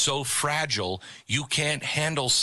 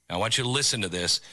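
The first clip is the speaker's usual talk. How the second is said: usual speaking voice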